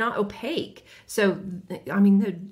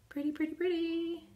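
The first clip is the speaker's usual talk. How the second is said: cooing; 7 semitones higher, 8 dB quieter than usual. Real, not acted. Cooing at color.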